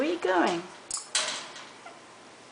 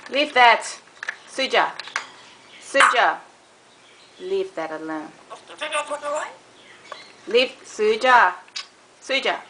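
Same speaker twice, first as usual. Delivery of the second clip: scolding the bird; 2 semitones higher than usual. Real, not acted. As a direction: tell the pet off sharply.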